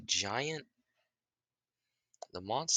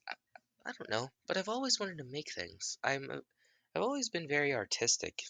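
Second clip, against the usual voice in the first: put-on higher child's voice; high kid voice; 8 semitones higher than usual. Kid telling Naruto he likes to make things, high. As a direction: answer like a shy boy, pitched up.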